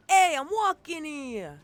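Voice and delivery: loud, drawn-out Brooklyn holler; Brooklyn accent. Shown as a demonstration. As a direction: shout it high and slow like a Brooklyn local. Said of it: "give you the best Brooklyn accent".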